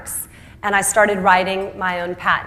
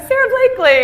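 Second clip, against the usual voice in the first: laughing, amused; 12 semitones higher, faster than usual. Retold or imitated. Giving her name a third time to manufacturers, laughing high. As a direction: answer your own name again, cracking up.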